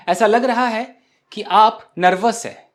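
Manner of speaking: normal talking voice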